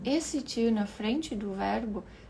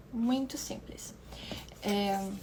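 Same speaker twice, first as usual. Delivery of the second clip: sussurrado; 4 dB quieter, slower than usual. Real, not acted. Aside sussurrado.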